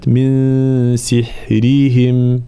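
very slow سحرهم with a light raa; سحرهم براء مرققة. Shown as a demonstration. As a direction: stretch سحرهم, keep the raa thin. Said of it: "الراء مرققة".

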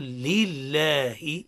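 "لله" very slow, with a clear kasra, doubled lam and long a; slow, articulated "لله". Shown as a demonstration. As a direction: spell out "لله" slowly for repetition. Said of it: "قول". (نطق متأنٍّ لكلمة لله)

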